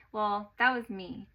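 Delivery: ordinary delivery, nothing marked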